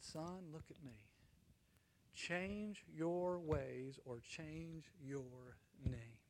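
soft, very slow, weighty retold warning; grave admonishment. Alexander admonishes the soldier who shares his name, gravely. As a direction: lay down each word slowly and quietly.

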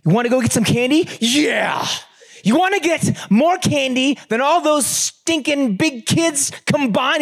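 put-on rally a little boy for candy; hyping up the kid. Dad hyping up his son.

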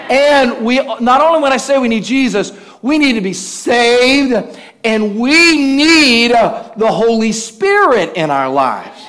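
slow, loud, ringing preacher's emphasis; preaching at full force. Acted. Preacher driving home the need for Jesus, full-force preaching. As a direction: lean into each "we need" like a pulpit climax.

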